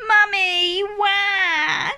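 high loud wailing cry; baby rabbit wailing for mum; crying bunny. Acted. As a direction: wail for mummy like a toddler. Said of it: "cries Richard".

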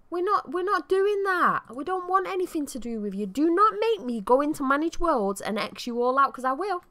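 high, loud mock threat; mock telling-off. Player warns vampire Sims off her household, mock telling-off. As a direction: lay down the law, playfully indignant.

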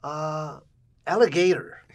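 high, loud imitation of his mother; loud, high mock-mom pronouncement; mother's broken English. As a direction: announce it proudly as an imitated mom. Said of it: "She speaks broken English".

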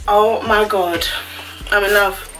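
ordinary talk, nothing marked

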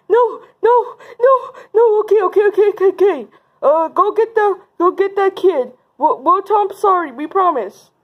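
high, repetitive pretend pleading; frantic giving in. Bullies threatened with parents, frantic pleading. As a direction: beg in mock panic.

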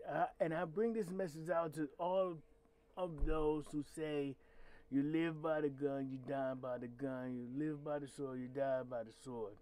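ordinary delivery

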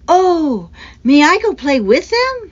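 loud, high eager plea; excited asking. A narrator's reading. Boy spotting his friends, excited request. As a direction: burst out with delight at seeing friends.

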